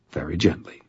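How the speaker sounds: ordinary delivery, nothing marked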